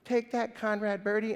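hammed-up nerd confronting a rival; best nerdy voice. Hugo confronting his rival in the musical, nerdy voice. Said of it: "In my best nerdy voice".

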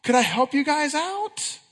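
high, slow, sweet offer of help; kindly helper voice. Pretend. Pastor showing how the repairman should have spoken, sweet high offer. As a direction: sound eager to help strangers. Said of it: "he should be like".

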